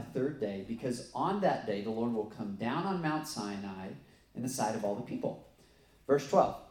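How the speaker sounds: loud, emphatic Bible reading; preacher reading scripture with force. Acted. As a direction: proclaim the verses to the congregation. Reads scripture aloud.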